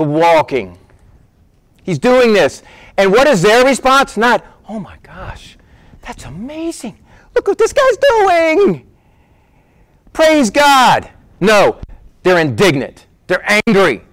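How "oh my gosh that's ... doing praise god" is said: higher, gushing mock wonder; mock amazement. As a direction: act out the praise they never gave. Mocking the praise they didn't give.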